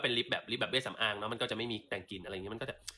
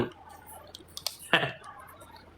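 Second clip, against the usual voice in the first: breathy laugh. Real, not acted. Laughs after mock snob bit.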